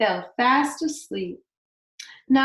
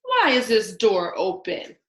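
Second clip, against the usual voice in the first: a lightly voiced gruff father bear, a touch louder; gruff Daddy Bear; 4 dB louder than usual. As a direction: give the big bear a hint of gruffness. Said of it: "in his deep, gruff voice".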